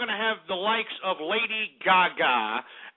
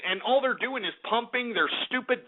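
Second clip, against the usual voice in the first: ordinary talk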